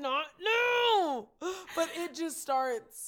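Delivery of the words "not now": loud, high tearful yelp; exasperated mock cry. Telling her tearing eyes to stop, loud mock-desperate cry. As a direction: burst out at your own tears, hammed-up. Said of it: "randomly your eyes will start tearing up".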